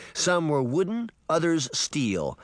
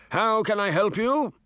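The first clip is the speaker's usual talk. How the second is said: higher magic mirror voice; 9 semitones higher, 3 dB louder than usual. A narrator's reading. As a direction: lift into the mirror's voice. Mirror character voice.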